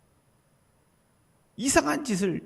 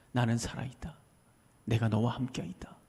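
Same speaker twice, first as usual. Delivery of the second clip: soft breathy whisper, no voice at all; whispered voice of God; 10 semitones lower, 9 dB quieter than usual. Acted. God whispering to each listener's heart, hushed.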